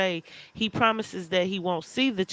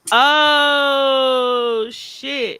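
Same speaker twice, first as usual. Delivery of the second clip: sudden high cry of alarm; shocked shout; 7 semitones higher, 5 dB louder than usual. Real, not acted. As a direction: yelp out in shock. Shocked by cousin calling.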